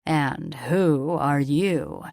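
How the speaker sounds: slow, lingering, oily question with a leer; sleazy drawl. A narrator's reading. Richter leering at Ilea, slow sleazy drawl. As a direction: stretch every word out like a creep sizing her up. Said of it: "he asked in a sleazy drawl".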